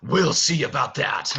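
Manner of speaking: a loud burst of menace; Beast losing his temper. Acted. Enraged master about to push people aside, threatening. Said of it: "when he gets like this".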